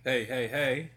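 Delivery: booming grave greeting; Fed Albert voice. Acted. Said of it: "That was his best Fed Albert impression".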